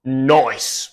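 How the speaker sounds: "noise" said as noice, oi vowel for nice; imitating the meme "noise", nice turned to noice. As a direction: quote the meme "noise" with rounded oi. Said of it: "the British guy that does".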